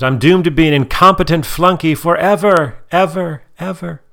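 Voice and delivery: fading echo on ever, ever; echo effect. Retold or imitated. Mom quoting Isabella with a fading echo. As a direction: let the last ever trail away like an echo. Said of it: "I added the echo part".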